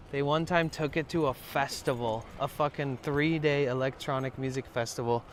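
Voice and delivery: usual speaking voice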